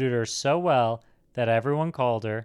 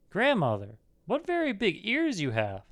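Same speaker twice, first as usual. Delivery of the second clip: put-on high-pitched girlish amazement; surprised little girl; 8 semitones higher than usual. A narrator's reading. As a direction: marvel in a much higher girl's voice. Surprised girl questions disguised wolf.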